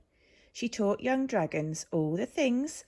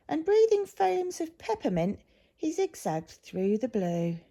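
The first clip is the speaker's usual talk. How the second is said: higher then settling, airy flourish; playful breathy narration; 7 semitones higher than usual. A narrator's reading. Narrator tells of Zog's peppermint breath, a playful airy voice. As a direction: puff out the peppermint line.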